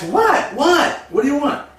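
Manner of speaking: high, loud irritated snap; annoyed husband. Retold or imitated. Husband snapping back at his wife in the movie, irritated and loud. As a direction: bark it out, irritated.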